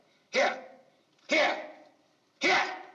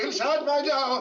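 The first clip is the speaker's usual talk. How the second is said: higher, louder, slow demo of the line; 10 semitones higher, 8 dB louder, slower than usual. Shown as a demonstration. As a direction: call it out loud and slow for her.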